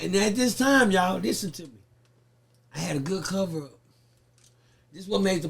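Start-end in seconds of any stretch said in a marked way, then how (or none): none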